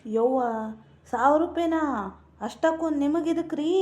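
high, loud cry of disbelief; shocked disbelief. Acted. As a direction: gasp out the amount as if it's outrageous. Wife shocked at money request.

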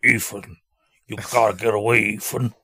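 lightly voiced slumped Ving Rhames; a hint of a drowsy tough guy warning; mumbling Ving Rhames. Mocking Ving Rhames warning Ethan, lightly voiced. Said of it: "And he's like".

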